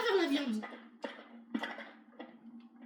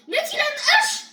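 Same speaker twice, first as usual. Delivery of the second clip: high, loud yell of alarm; alarmed cry; 10 semitones higher, 10 dB louder than usual. Pretend. Player spots Freddy with an axe, alarmed yell. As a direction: burst out with a panicky warning.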